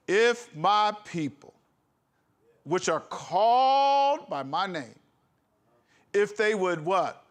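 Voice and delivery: slow, deliberate preaching; solemn scripture reading. Acted. Preacher quoting King Solomon to the congregation. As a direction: draw out each phrase like a sermon.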